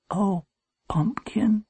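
hushed, emotional greeting; tender. A narrator's reading. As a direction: breathe the pet name fondly. Long-absent mother greets her son.

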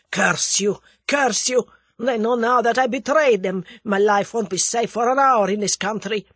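loud, forceful cursing in character; vehement outburst. A narrator's reading. The Russian curses his captor for endangering him, vehement. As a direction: burst out bitterly at full force. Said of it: "He burst out vehemently".

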